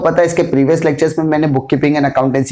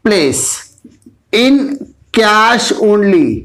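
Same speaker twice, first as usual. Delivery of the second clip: slow dictation; 2 semitones higher, much slower than usual. Shown as a demonstration. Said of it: "teacher dictating a definition slowly".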